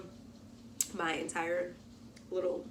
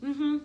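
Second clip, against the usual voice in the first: high, emphatic mm-hmm; loud high mm-hmm; 9 semitones higher, 9 dB louder than usual. Real, not acted. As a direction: confirm it loudly, teasing. Answering imagined viewers.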